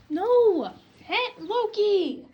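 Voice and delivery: loud, slow calling out of the name; No! shouted, then the name dragged out slowly; sharp yell. Real, not acted. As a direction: stop the pet mid-jump with a yell. Yelling at animal to stop.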